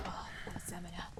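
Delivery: quick whispered aside; whispering. Real, not acted. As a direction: breathe it to yourself.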